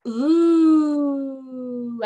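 loud rising ooh of delight. Shown as a demonstration. Teacher showing kids the circus food sound, a loud delighted ooh. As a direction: ooh like you can't wait to taste it. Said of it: "you can make this sound".

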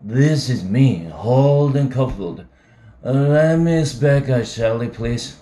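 put-on drunk. Drunk Holden on the phone.

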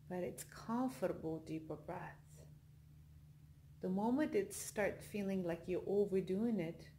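soft, unhurried meditation voice with long pauses; guided meditation. Acted. Leads a yoga nidra relaxation.

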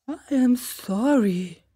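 a lightly voiced owl, slow and polite; politely disagree like a learned owl; owl doctor. Owl contradicting the crow, lightly voiced and polite.

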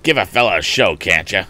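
loud, put-on irritated complaint; surly bully protesting; bully's growl. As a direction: gripe back resentfully. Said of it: "growled Andy".

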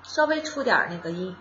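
usual speaking voice

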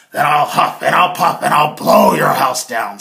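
loud menacing threat; threatening wolf. Acted. Wolf threatens Herman's wooden house, loud. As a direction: bellow the threat. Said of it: "said the wolf".

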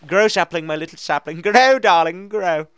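high, loud, doting coax; tender coaxing. Pretend. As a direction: sweet-talk the little tree. Coaxing saplings to grow.

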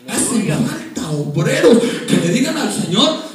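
loud, high, impassioned pulpit delivery; full-force preaching. Real, not acted. Preacher urging the congregation to serve God, impassioned preaching. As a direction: build to a drawn-out, crying-out climax.

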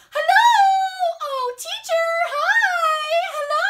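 very high, loud sing-out greeting; mom yelling hello. Acted. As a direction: holler gushingly across the mall. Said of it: "his mom yelled".

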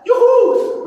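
high, loud laughing whoop; joyful cheer. Real, not acted. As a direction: celebrate out loud. Cheering after the set.

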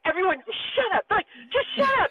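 high, urgent shushing of the room; hushing everyone. Retold or imitated. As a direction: shush the family before the big moment. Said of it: "you're like".

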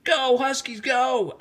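high, rousing cheer for the team; excited yell. A narrator's reading. Rebecca cheering her team on, excited shouting. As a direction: shout them on, last chance. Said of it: "Rebecca yelled".

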